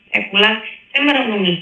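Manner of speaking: ordinary talk, nothing marked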